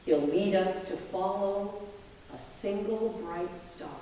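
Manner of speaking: ordinary delivery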